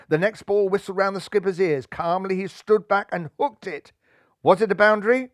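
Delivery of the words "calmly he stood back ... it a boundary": ordinary delivery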